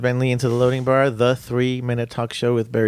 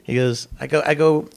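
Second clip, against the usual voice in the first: ordinary delivery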